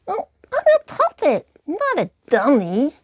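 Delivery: high, loud puppet protest; indignant puppet. Acted. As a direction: puppet sniffing back at the insult. Periwinkle retorts to Mary.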